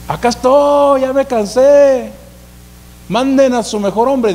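loud high shout, very slow on ya me cansé; bored giant bellowing the same dare; weary taunting yell. Retold or imitated. Goliat taunting again, day forty. (grito cansado y burlón, fuerte)

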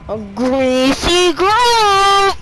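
high, loud, slow yell of the name; stretched hyped-up shout; loud drawn-out hype. Pretend. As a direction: shout it like an announcer. Hyping drop location.

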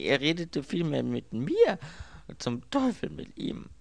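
höher und lauter, verärgert. A narrator's reading. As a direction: den Ärger über ihn rauslassen. Anthony flucht über Dieb. (Anthony, gereizt fluchend)

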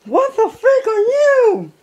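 very high, loud, slow shriek with a laugh; startled shout. Retold or imitated. Former teammate spooked by the costume, shrieking with laughter. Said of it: "and then he goes like".

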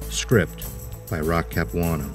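usual speaking voice

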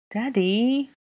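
put-on high, whiny small-child complaint; grumbling child. A narrator's reading. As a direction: grumble it like an upset little kid. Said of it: "Aurelian grumbled".

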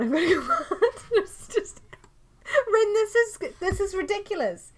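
high, loud, laughing disbelief; laughing exasperation. Real, not acted. Streamer to Rin about dogs everywhere, exasperated laughing. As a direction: protest helplessly through real laughter.

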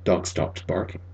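ordinary delivery, nothing marked

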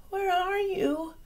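tiny squeak, very high and thin; squeaky baby bat. Acted. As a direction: call out like a lost baby bat. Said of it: "Stella Luna squeaked".

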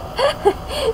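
squealing giggle, way up high; burst of high laughter. Real, not acted. Laughing hard at the misheard name, high giggle.

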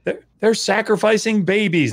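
hammed-up shocked apologist; mocking apologists. Scholar mocking apologists' excuse, mock outraged. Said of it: "Like, "Look, they're".

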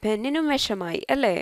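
ordinary delivery

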